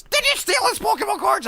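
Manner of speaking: high-pitched accusing mom voice; imitating a furious mom; angry mother. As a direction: demand it like an outraged mother. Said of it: "my mother is so pissed at me".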